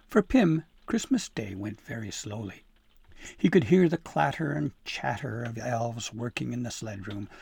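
plain reading voice

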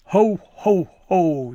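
loud, high jolly ho ho ho; booming laugh. Acted. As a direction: boom it out like Santa. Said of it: "the chief elf laughed".

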